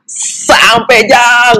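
high excited call to a friend; excited shout. Retold or imitated. Telling friends they've arrived, high excited call. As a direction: cry out the arrival in relief. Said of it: "gua langsung teriak".

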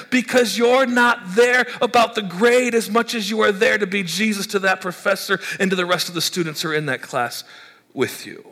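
ordinary talk, nothing marked

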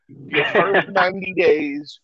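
loud, slow teasing proclamation; mock announcement. Host teasing cohost's dating rule, loud and slow. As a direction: drag it out loudly to tease Dante.

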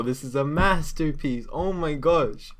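real fanboy enthusiasm; gushing fanboy. Real, not acted. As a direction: gush like a devoted fan. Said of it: "I'm like a fanboy with this".

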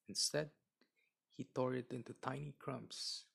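ordinary delivery

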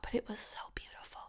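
quick, awed whisper; whispered aside. Real, not acted. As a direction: drop suddenly into a hushed whisper. Hushed aside after complaining about walk.

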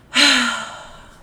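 loud, heavy put-on sigh; exasperated sigh. Reacting when someone tells you something, loud exasperated sigh. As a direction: let out a big audible breath, acting fed up. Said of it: "Ya con la respiración".

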